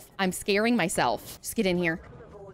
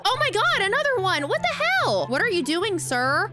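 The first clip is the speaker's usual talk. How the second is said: high, indignant squeal; high indignant shrieks; outraged disbelief; 12 semitones higher than usual. Real, not acted. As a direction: protest at the thieving fish. Stalkers steal her camera.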